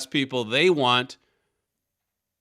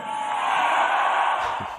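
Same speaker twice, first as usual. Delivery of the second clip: breathy laugh. Real, not acted. Host reacts after clip.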